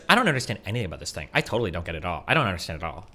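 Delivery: low, quiet mock-clueless quote; mock willful ignorance. Reactor imitating people refusing to understand, mildly mocking. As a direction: drop low and soft, imitating someone who won't try. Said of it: "Why would you wanna be like".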